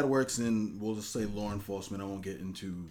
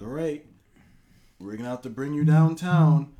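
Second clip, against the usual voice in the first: mock cop; 6 semitones higher, 12 dB louder than usual. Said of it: "He's like".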